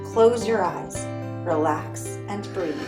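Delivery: ordinary delivery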